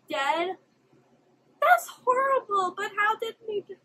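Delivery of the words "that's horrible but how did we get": upset, higher voice on the verge of crying; near tears. Real, not acted. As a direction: protest as if about to cry. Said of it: "I'm literally gonna cry".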